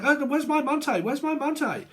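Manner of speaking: very high-pitched mimicked wail of a demand; mock ranting. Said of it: "ranting and raving and screaming".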